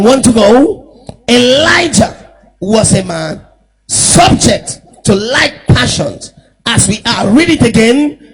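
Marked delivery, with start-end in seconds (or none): 1.3-7.3 shouted scripture; shouted reading. Real, not acted. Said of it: "Please, let's read it again".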